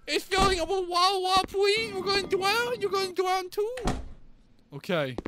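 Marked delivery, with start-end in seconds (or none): 0.1-3.7 high, pleading cries; frantic pleading. Real, not acted. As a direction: beg them to stop the flood. Room flooding, pleading.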